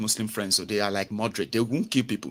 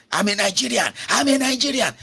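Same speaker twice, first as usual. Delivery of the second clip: proud declaration; 11 semitones higher than usual. Retold or imitated. Mock proud Nigerian quote.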